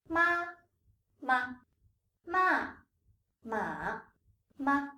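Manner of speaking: each "ma" separated by a pause, with its own tone; slow tone drill. Shown as a demonstration. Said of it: "I'm gonna read them slowly twice".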